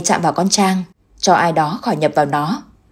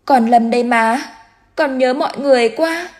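put-on high, small, tearful child's voice; missing his family so much it hurts; crying child; 6 semitones higher than usual. A narrator's reading. Dead son telling his mother he misses everyone, high and tearful. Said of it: "Nó lại khóc".

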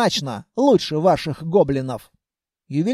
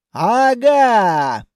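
loud, raised "caught you" cry; gotcha shout; 4 semitones higher, 5 dB louder than usual. Acted. Grandmother springs out before the runaway, a triumphant shout. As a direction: call out sharply on catching a runaway.

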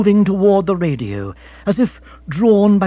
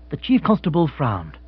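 plain reading voice

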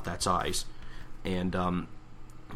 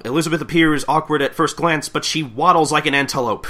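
raised, louder commentary; announcer; 6 semitones higher, 7 dB louder than usual. Acted. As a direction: size up the racer for the crowd. Acting the announcer's race call.

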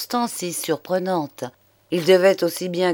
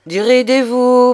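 hearty call; 6 semitones higher, 8 dB louder than usual. A narrator's reading. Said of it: "dit-il enfin".

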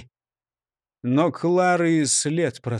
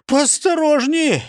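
startled protest; 9 semitones higher, 4 dB louder, slower than usual. A narrator's reading. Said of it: "чихнув, сказал Нисычих".

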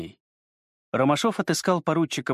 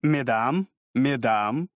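gallant cavalier; much slower than usual. A narrator's reading. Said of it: "изображая собою ... любезного кавалера".

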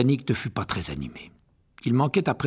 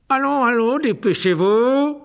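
high, loud, slow bark; 13 semitones higher, 8 dB louder, slower than usual. A narrator's reading. Teacher barking orders at the pupils, loud. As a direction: snap the class into moving. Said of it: "aboya Rogue".

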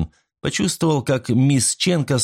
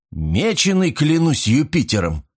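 higher-pitched indignant oath, read in story; a narrated outburst, pitched up; exclaiming; 6 semitones higher than usual. A narrator's reading. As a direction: exclaim it high, no real shout. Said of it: "воскликнул он".

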